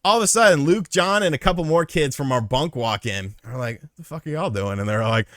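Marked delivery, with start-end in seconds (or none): 3.8-4.8 soft, slightly high retold question; puzzled question. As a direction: ask it under your breath. Retold question to arriving bunkmates.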